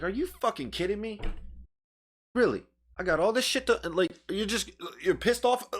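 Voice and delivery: high-pitched incredulous protest; baffled, irritated. Retold or imitated. Retelling his friend walking away, baffled and irritated. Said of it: "I'm confused. I'm irritated".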